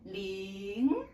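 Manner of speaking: "ling" starts low and climbs; rising second tone. Shown as a demonstration. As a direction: draw out "ling", sliding up like a question. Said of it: "start lower and then go up".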